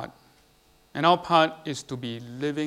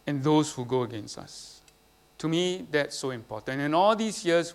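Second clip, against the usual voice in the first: ordinary talk, nothing marked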